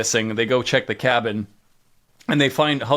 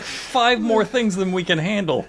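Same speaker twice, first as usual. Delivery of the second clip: higher, exasperated and laughing; incredulous writers; 9 semitones higher than usual. Retold or imitated. Writers scrambling to fit a Predator in, exasperated and laughing. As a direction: play the baffled writers' room.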